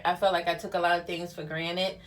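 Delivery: ordinary delivery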